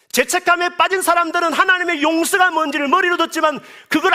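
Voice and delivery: high, loud, urgent sermon; fervent preaching. Acted. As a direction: press the congregation hard. Preaching at full force. (높고 힘찬 목소리로 다그치듯 설교하듯)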